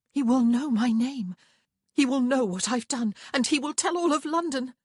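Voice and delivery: high, loud, mounting dread; frantic, emphatic alarm; panicked. A narrator's reading. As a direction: build the panic phrase by phrase. Woman dreads scandal, panic building.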